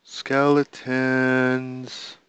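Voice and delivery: slow, drawn-out skeletons. Real, not acted. Gamer spotting skeletons, slow drawn-out word. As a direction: stretch the word out in alarm.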